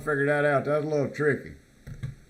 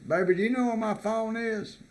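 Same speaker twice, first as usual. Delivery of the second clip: quoting himself; 8 semitones higher than usual. Retold or imitated. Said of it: "if I say".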